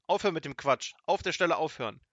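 loud, high scolding order; exasperated wizard's shout; called out. A narrator's reading. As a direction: order him to stop at once. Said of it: "rief der große Zauberer Zwackelmann".

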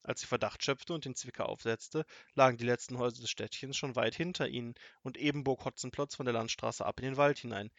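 usual speaking voice

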